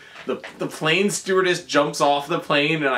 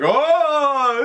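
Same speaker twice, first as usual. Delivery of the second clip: sudden high, loud "Oh!"; surprised cry; 11 semitones higher, 5 dB louder than usual. Retold or imitated. Munsters in the car, startled, high loud cry. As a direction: yelp it out in shock. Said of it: "they're like".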